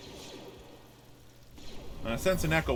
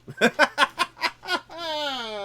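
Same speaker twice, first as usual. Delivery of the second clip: loud laugh; 6 dB louder than usual. Real, not acted. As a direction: burst of high laughter. Laughing at climbing through.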